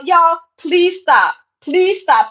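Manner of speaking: slow and firm, each word weighed; slow emphatic plea. Real, not acted. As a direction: beg them to stop, slowly. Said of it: "pleading with viewers".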